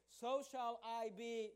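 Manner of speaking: "So shall I be" slowly, word by word; emphatic verse reading. Shown as a demonstration. Preacher building up to the key verse word, emphatic. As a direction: space out "So shall I be" with weight. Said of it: "notice the emphasis".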